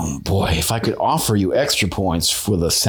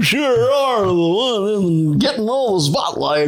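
high goofy cartoon voice; high dopey cartoon dog drawl; 10 semitones higher, slower than usual. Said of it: "your best Goofy impression".

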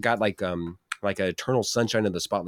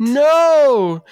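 excited; 8 dB louder than usual. Real, not acted. Host rejects answer loudly.

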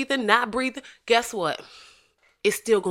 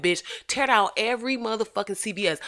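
usual speaking voice

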